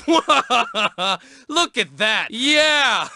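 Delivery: mocking while laughing. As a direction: laugh through the words.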